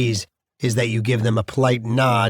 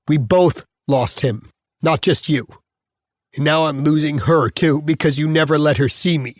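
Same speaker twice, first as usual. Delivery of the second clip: indignant rant with raised questions; bitter accusation; 4 semitones higher than usual. Acted. Prisoner railing at wife Emily for withholding daughter, bitter indignation. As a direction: throw each question at her as an accusation.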